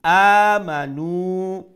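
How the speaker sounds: আমানু chanted loud and very slow, long outer vowels; one alif long. Shown as a demonstration. Teacher repeating the word for students, long loud chant on আমানু. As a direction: open loudly and sustain the first vowel of আমানু. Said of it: "এক আলিফ লম্বা করি".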